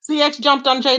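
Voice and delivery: ordinary talk, nothing marked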